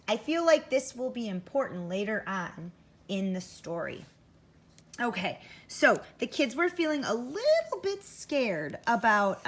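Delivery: ordinary delivery, nothing marked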